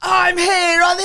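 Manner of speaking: loud, high, drawn-out reporter shout; imitating a windswept reporter; mock storm correspondent. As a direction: shout a report over a howling gale. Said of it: "he's like that".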